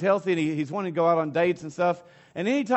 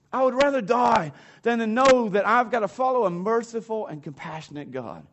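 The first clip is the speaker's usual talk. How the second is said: higher and louder, a sulky overdramatic complaint; overdramatic cranky; 4 semitones higher, 3 dB louder than usual. Retold or imitated. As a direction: whine it like a cranky prophet throwing a tantrum. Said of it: "He might be a little overdramatic with his cranky".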